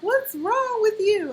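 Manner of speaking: high, slow, drawn-out disbelief; mock incredulous retort. Wife firing back at her husband, high slow mock disbelief. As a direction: stretch it out like you can't believe him.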